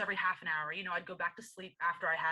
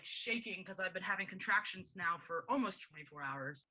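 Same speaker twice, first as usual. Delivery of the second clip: ordinary delivery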